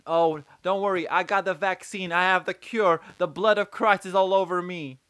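high, loud mock churchgoer. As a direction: ham up a zealous believer reassuring the reporter. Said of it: "the people are literally saying".

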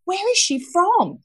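high, slow puzzled wondering; puzzled quote. Retold or imitated. Host reenacting her puzzlement at the guest's accent. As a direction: ask it as if baffled.

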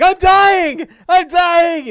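very high, loud shrieking; panicked dying wail. Acted. Bing reaching for his vicious axe cut, shrieking in panic. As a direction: wail hysterically over a wound. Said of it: "Bing is like".

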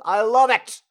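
loud, high burst of delight; delighted shout. Acted. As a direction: belt out how much you love it. Delighted outburst after his impression.

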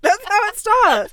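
breaking up with laughter; laughing. Real, not acted. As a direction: talk through giggles. Reading cringe message, laughing.

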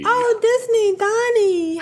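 high, bright-eyed cooing of a name; excited high coo; delighted greeting. Real, not acted. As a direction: greet him like a beloved friend. Host greets listener email warmly.

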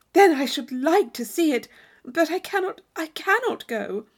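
longing but refusing; a hint of wistful regret; Catherine torn.

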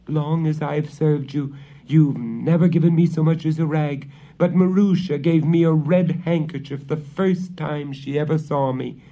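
high, loud aggrieved complaint; complaining servant girl. A narrator's reading. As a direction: air every grievance at the witch.

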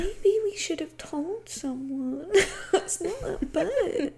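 high, slow, breathy baby voice mimicking Hannah. Friend mocks Hannah's whiny baby voice, high and slow. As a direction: whimper it like a small child, laughing through it. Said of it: "this baby voice".

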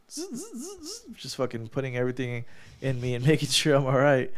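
quick buzzing sound effects; race-pit zzz noises; imitated buzzing. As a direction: mimic whirring pit-crew tools with short zzz bursts. Imitating pit crew noise in operating room.

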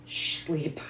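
very soft whispered shush; barely-there whisper; hushing whisper. Retold or imitated. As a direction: hush a wound-up child to sleep. Retells hushing small daughter to sleep.

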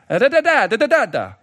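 mock Italian. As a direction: rattle off fake Italian loudly, falling away.

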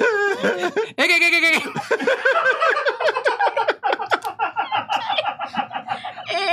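rapid high clicking chatter; dolphin clicks. Shown as a demonstration. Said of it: "let me get closer for the podcasters".

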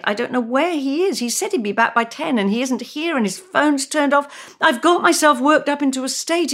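a wife frantic about her late husband; anxious caller. Acted. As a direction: pour it out in a fretful rush. Reads anxious Christine on phone.

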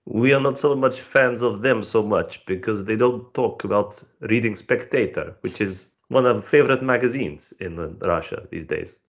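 hammed-up Russian accent; broad comic Russian accent; mock Russian accent. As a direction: play it as a proud Russian comedian in a thick Russian accent. Comic Russian guest persona.

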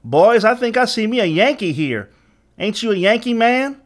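high, loud twangy gang leader; twangy youth crowing to his gang; youthful, twangy voice. A narrator's reading. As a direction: taunt him in a young Southern twang. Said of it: "twangy voice rang out".

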